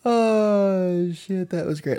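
high, giddy, laughing reaction. Real, not acted. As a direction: gush through the giggles.

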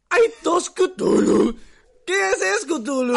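loud, high, excited shout; excited calling out. Acted. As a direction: call out in high-pitched amazement. Acted surprise at a second Cthulhu.

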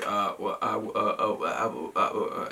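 stammering, fumbling for an excuse; imitated stuttering. Caught by the store owner while sneaking out, stuttering. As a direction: act out being caught with nothing but stuttered fillers. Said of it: "and I'm stuttering".

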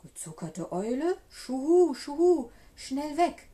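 loud, high hooting owl cries; startled owl calling out loudly. A narrator's reading. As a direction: hoot in alarm, building from the first question. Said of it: "rief die Eule".

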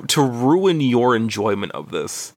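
normal talking voice

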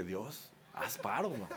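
slow, soft plea under the breath; quiet, comic private prayer; pleading aside. Retold or imitated. Pastor privately begs God for a favour, pleading aside.

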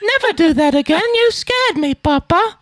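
put-on very high, plaintive child voice; hammed-up little waif pleading with Papa; old-timey British child. Son acting a scared child begging his papa, old-timey British waif. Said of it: "say it like you're".

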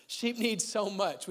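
slow words broken by real laughter; laughing through it. Real, not acted. Preacher to congregation, laughing at the sheep joke. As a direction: giggle out the line slowly. Laughs at own offensive point.

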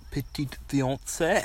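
a friend quoting Andy from The Office in mock French; imitating Andy. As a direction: drag out the French like a show-off. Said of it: "where Andy's like".

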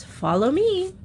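high, grand queen command; queenly voice. Acted. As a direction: regal call to follow. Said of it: "in her most queenly voice".

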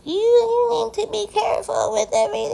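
high shrill-edged old-lady mock cry; mocking old folk. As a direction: caricature a fussy old person. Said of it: "it's always the old folk".

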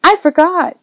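put-on high little-boy outburst; boyish exclamation. A narrator's reading. Boy remembers his errand, exclaiming. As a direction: exclaim it like a kid suddenly remembering. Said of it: "Joe exclaimed".

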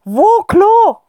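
high, loud, hurried alien voice; frantic squeaky plea for the loo; gasping alien. Acted. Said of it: "präzisiert das Alien". (hohe, laute, gehetzte Alienstimme)

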